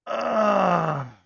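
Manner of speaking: put-on loud high old man grunt; old man groan. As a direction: groan like getting out of a car. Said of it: "I've been practicing my old man noises".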